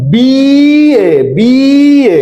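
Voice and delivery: teacher calling out বিএ to the class to show its first sound; loud demo pronunciation. Shown as a demonstration. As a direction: announce বিএ loudly twice, hitting the "bee". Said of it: "দেখো এইটা বলছি".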